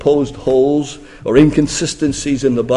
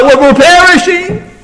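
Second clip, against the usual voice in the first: very high, loud mock cry of terror; a hammed-up terrified shout; mock panicked scream; 4 dB louder than usual. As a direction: act out the disciples screaming in fear. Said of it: "they might just scream out".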